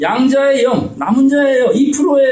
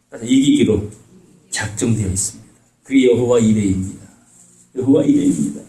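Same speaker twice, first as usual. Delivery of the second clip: ordinary delivery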